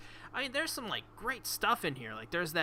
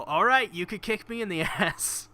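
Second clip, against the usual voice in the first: louder, higher, cracking up; amused raised-voice retelling; laughing mock quote; 6 semitones higher, 7 dB louder than usual. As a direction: imitate her offer, breaking into laughter. Quoting the girl, incredulous, laughing.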